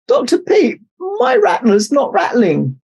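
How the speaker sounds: high-pitched, whiny snake complaint; a snake moaning about his tail, pitched high; high snake voice groaning. A narrator's reading. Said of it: "Jake groaned".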